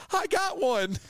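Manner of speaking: put-on high excited girl voice; girl's squeal. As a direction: squeal it like a girl who just won a prize. Girl grabs his arm, claims him.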